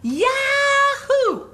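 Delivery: very high, laughing yahoo shout; joyful whoop. Retold or imitated. Imitating the skiing woman's gleeful downhill yell, laughing. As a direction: whoop like you're loving the ride. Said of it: "she was going".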